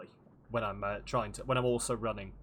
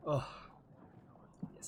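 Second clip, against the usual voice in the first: ordinary talk, nothing marked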